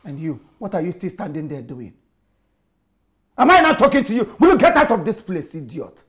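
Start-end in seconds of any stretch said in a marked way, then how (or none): 3.4-6.0 high, very loud angry order; furious shout. Acted. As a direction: roar at the maid to leave. Boss orders the maid out.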